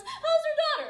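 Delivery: high, loud acted question; psychiatrist's question. Psychiatrist's question in told joke.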